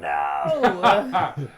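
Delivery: loud outcry of dismay. Real, not acted. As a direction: shout your dismay. Player hears they are out of range.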